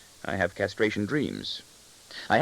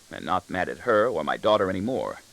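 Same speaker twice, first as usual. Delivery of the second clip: usual speaking voice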